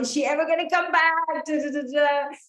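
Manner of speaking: high, loud and frantic; anxious boyfriend panicking as his girlfriend leaves; panicky. Retold or imitated. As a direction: act like you're freaking out she won't come back. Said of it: "like, you're freaking out".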